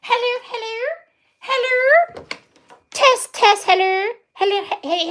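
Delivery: usual speaking voice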